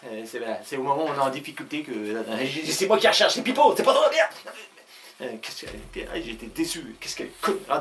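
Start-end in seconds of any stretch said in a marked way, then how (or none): 2.7-4.3 much higher and louder, mock-outraged protest; indignant outburst. Pipo protesting to his wife that he is the one being hunted, mock-outraged. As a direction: puff yourself up in hammed-up indignation.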